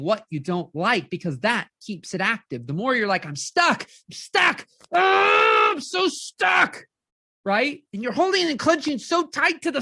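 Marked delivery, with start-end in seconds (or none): 3.3-6.9 rising into a hammed-up wail, much higher; exaggerated crying complaint, higher and louder on the oh; mock wailing. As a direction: whine it like you're drowning in stuckness, building to a cry. Acting out a stuck client.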